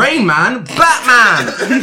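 high, loud indignant yell; outraged shout. Retold or imitated. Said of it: "Oh, he said".

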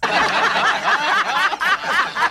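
a high cackle in a falsetto voice; high falsetto laugh. Acted. Laughing after own line.

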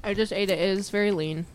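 talking through a mouthful; words round a bite of food; mouth full. Real, not acted. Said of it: "My mouth is so full".

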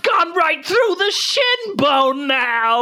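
high, slowed hammed-up wail; mock panic. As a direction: silly fake terror at the blades. Mower cuts her shin.

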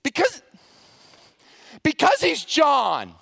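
fed up, she finally blurts it out; the student, cornered in class, blurts out her answer in exasperation; exasperated. Retold or imitated. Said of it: "in exasperation".

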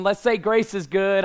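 call and response. Real, not acted. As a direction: pump up the congregation. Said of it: "Come on".

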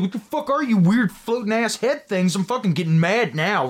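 high, riled-up complaint; getting mad. Retold or imitated. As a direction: build into mock anger. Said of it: "He's like".

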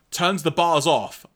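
ordinary talk

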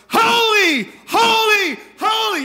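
very high, loud frantic shouting; freaking out. Acted. Seraphim flying around Isaiah crying out, freaked-out shouting. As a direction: shriek each word like a panicked creature. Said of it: "freaking out, going".

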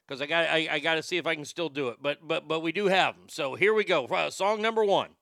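talking around a dip; chewing tobacco. Real, not acted. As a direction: lip full of tobacco. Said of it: "there's a dip in my mouth".

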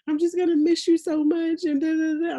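put-on a small boy wailing that he'll miss mom; imitating tearful son. Mother imitating her son crying at her goodbye, mock tearful. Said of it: "he starts hysterically crying".

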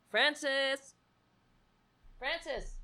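high, soft coaxing call; cat-calling voice. Real, not acted. Owner coaxing her cat, high and soft. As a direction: sweet-talk the cat over.

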